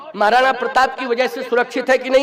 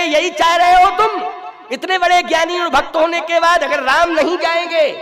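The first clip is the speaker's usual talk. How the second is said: high, sustained indignant oratory; impassioned preaching; 7 semitones higher, 3 dB louder than usual. Acted. Preacher challenging those who would stop Ram, impassioned preaching. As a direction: pile up rhetorical questions at a raised pitch. Impassioned rhetorical questions to congregation.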